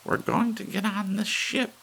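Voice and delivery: put-on firm old lady who won't be swayed; determined old lady. A narrator's reading. Missus Bellows speaks to Thurkell.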